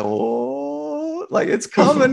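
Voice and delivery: high, mock-excited "here we go"; teasing teammates. Teammates egging on the standoff, high mock excitement. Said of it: "all the guys were like".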